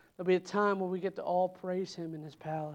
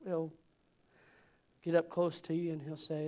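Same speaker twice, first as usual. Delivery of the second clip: ordinary delivery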